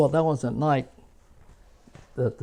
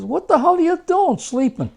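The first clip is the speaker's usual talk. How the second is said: angry yell; 7 dB louder than usual. Retold or imitated. Sergeant wakes sleeping soldier.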